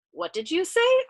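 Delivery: high, loud question; uncomprehending query. A narrator's reading. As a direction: ask high, calling over the noise. Navigator still cannot hear.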